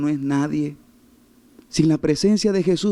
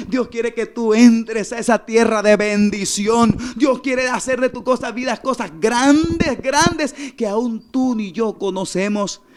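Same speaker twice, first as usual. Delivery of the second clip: raised, stretched-out proclamation; fervent preaching; 5 semitones higher, 4 dB louder, slower than usual. Real, not acted. Preacher proclaims God's blessing and warning to Moses, fervent preaching. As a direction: proclaim it with long, emphatic key words. Preaches promise at full force.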